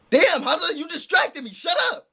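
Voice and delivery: sudden high exclaim at a friend; playful outburst. Real, not acted. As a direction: cry out, distracted and annoyed. Streamer snaps at friend Haza.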